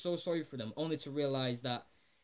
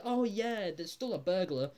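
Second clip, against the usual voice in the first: fake cheerful; 6 semitones higher, 3 dB louder than usual. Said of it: "So they act all happy at first".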